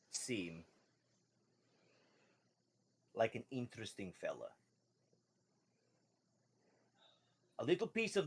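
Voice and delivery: lightly voiced fish merchant, halting delivery; a hint of a sly shopkeeper; fish man merchant. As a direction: size up a customer with long pauses. Game master plays the fish merchant.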